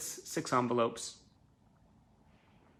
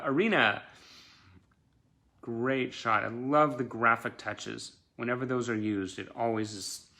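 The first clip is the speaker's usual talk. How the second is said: ordinary talk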